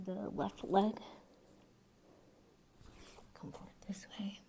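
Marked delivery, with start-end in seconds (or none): 3.4-4.4 fast, breathy whisper. Shown as a demonstration. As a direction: whisper the cue quickly. Yoga cue said twice.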